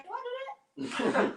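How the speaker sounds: slow, high-pitched voice of someone wondering about themselves; acted self-doubt. Voicing a listener's startled thought.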